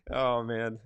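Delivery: normal talking voice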